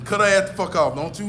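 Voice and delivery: ordinary delivery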